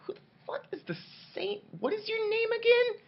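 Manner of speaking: very high, squeaky incredulous question; hammed-up falsetto disbelief; mock bafflement. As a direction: pipe up as a baffled crowd. Acted baffled crowd reply.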